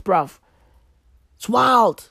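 normal talking voice

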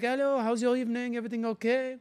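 high-pitched put-on French accent. Tourist greeting girls in a bar, French accent. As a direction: chat brightly in a fake French accent. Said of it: "I'm talking like this".

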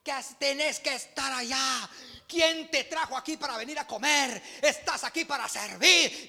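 mock angry rebuke, slowed on the first words; retold scolding. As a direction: play the angry boss, half-hearted. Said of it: "me pegó una gritada".

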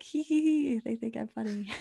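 mock giggle. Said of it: "And I was like".

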